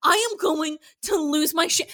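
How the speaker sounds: loud, exasperated real rant; angry rant. Real, not acted. Host fuming over Gideon's line, angry rant. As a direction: rant at full tilt, incredulous. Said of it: "I'm angry".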